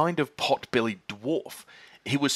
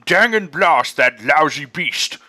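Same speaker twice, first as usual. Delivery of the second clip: slow, much louder furious curse in a coarse farmer voice; angry farmer's cry; 4 semitones higher, 9 dB louder, slower than usual. Acted. Boggis cursing the fox to the other farmers, furious. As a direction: spit it out at the thieving fox. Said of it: "cried Boggis".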